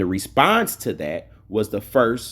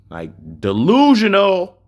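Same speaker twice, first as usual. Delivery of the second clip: scornful; 9 semitones higher, 6 dB louder than usual. Real, not acted. Mocking a rival's message.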